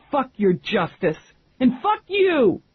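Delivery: high, cracking furious yell; tearful shout. A narrator's reading. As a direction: hurl it at her through tears. Tearful teen storms off.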